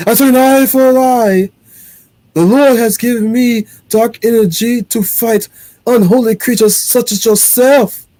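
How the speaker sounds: high, loud, hammed-up Scottish accent; bad Scottish accent for a zealous priest; overdone Scottish brogue, slowing at the end; terrible Scottish accent. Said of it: "That's my terrible Scottish accent".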